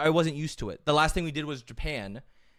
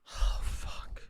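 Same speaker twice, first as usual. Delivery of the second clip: whispered dismay; 5 dB quieter than usual. Retold or imitated. Retelling his dismay while walking.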